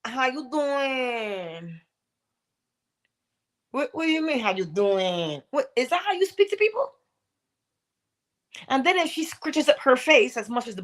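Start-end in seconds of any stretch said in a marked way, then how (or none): 0.0-1.8 drawn-out slow greeting; slow, casual "how you doing"; lazy pickup line. Retold or imitated. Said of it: "you have people who say". 3.7-6.9 high indignant reply, a hint of outrage; lightly voiced offended woman; mock offended retort.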